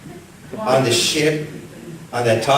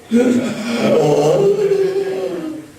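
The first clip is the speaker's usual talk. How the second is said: put-on sharp high gasp of a startled baby; sudden high-pitched gasp; child's shocked gasp; 5 dB louder than usual. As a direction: gasp like a baby feeling the needle. Child's reaction to the needle.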